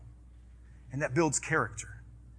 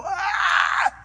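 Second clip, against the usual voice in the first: sudden high yelp, much higher; sharp cry of pain; 4 dB louder than usual. Retold or imitated. Son burning his hand on hot sauce, a high yelp. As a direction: yelp like a burnt boy. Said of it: "I heard him go".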